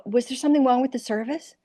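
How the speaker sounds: high-pitched, innocent Iowa waitress; put-on Iowa accent. Waitress asking bad tippers about service, innocent Iowa accent. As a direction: ask politely in a put-on Iowa voice. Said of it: "I'd turn on my Iowa".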